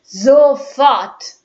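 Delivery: loud furious command; angry shout. Acted. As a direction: roar it in fury. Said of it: "schallte es zornig".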